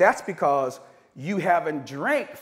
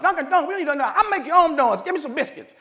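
mock Mama; 8 semitones higher, much faster than usual. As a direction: play the thrifty mother scoffing at the donuts. Mama refusing, voiced higher.

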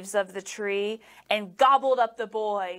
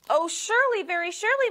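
put-on high, sweet boy pleading; sweetly urging little boy; coaxing boy voice; 9 semitones higher, faster than usual. A narrator's reading. Boy voice in story.

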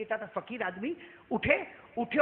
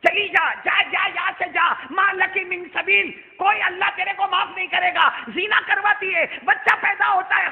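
higher, loud, slow angry shout; 10 semitones higher, 10 dB louder than usual. Acted. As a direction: drive her out in fury. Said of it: "गुस्से में खड़े हुए और कहा". (गुस्से में ज़ोर से चिल्लाकर भगाना)

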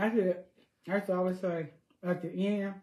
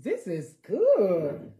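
savoring; 8 dB louder, much slower than usual. Real, not acted. Savoring the food.

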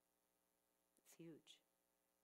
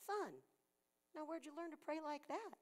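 father's voice goes high in wonder; a touched dad asking his boy; dad's amazed question; 9 semitones higher than usual. Retold or imitated. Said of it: "And I said".

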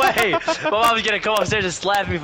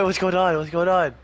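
ordinary delivery

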